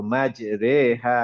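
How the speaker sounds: মাজরেহা slow with a clear e vowel; slow model reading of মাজরেহা; correct imala. Shown as a demonstration. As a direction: stretch মাজরেহা, রে between a and i. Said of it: "এ উচ্চারণ করব".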